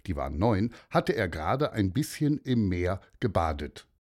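plain reading voice

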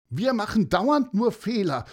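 ein Hauch von Schluchzen, hoch; leicht weinerlich klagen; schluchzend. Acted. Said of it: "schluchzte Alina". (Alina klagt dem Vogel leicht schluchzend)

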